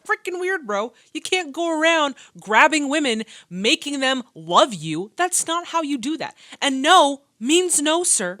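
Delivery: loud, high angry rant; angry scolding. Real, not acted. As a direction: rant loudly at him. Scolding the suitor.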